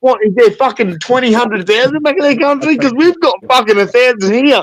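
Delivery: loud, high incredulous rant; disbelieving rant. Real, not acted.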